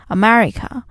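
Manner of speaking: ordinary delivery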